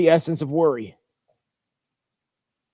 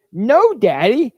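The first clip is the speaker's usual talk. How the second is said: put-on slow, higher little-kid voice; child chirping; 5 semitones higher, 3 dB louder, much slower than usual. A narrator's reading. Toddler answering his dad about his new friend, slow chirpy child voice. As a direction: chirp it out like a two-year-old. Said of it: "Bookie chirped".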